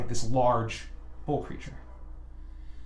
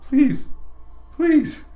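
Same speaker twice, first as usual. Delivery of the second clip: high, louder, pleading; begging captive; 12 semitones higher, 5 dB louder, much slower than usual. Acted. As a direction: plead higher on each please. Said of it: "he's like".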